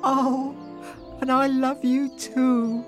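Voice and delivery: high, touched wife's voice; smiling affection. A narrator's reading. Wife answering her husband's love, smiling warmly. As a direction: coo it with a smile. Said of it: "replied Isabel with a smile".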